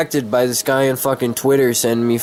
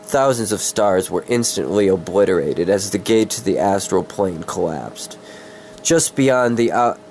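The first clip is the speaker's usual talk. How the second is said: dramatic story narration; 2 semitones lower than usual. Acted. Streamer reads his fantasy story to viewers, dramatic narration. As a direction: read it like an epic fantasy audiobook. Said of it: "trying to be all fucking dramatic".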